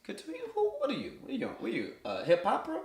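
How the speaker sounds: mock outrage at her phrasing; laughing disbelief at his wife; playful disbelief. Real, not acted. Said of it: "teasing wife".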